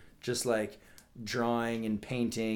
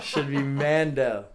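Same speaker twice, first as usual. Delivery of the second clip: louder, laughing repeat; 4 semitones higher, 7 dB louder, slower than usual. Real, not acted. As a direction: laugh it out, slowly. Repeats joke, second time laughing.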